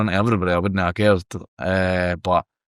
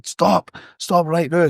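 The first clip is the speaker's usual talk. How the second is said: high, slow yelled command; retold shout; 8 semitones higher, slower than usual. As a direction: call out stop like you're chasing him. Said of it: "'cause I'm shouting".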